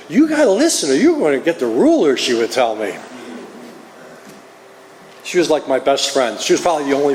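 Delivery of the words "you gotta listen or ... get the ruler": high-pitched Southern girl imitation; sweet Southern accent. Southern girl warning classmate about the ruler, sweet Southern accent. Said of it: "the sweetest Southern accent".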